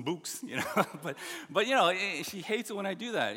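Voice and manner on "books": "books" with the long oo of boots; teasing mispronunciation. Retold or imitated. Husband teasing his wife about her accent, mispronounced "books". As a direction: say "books" to rhyme with spooks. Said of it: "making fun of her accent".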